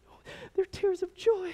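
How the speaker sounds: high-pitched mock weepy voice; imitating tearful wife. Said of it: "if she's crying".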